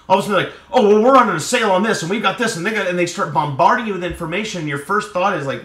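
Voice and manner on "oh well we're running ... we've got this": fast, loud, high sales pitch rush; pushy salesman. Retold or imitated. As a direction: gush it at the customer like an overeager clerk. Pushy salesperson bombarding customer.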